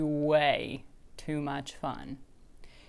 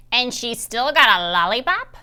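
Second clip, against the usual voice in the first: put-on louder, different wicked old woman; 5 semitones higher, 9 dB louder than usual. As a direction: sneer it like an old witch. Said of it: "It sounded like a wicked old".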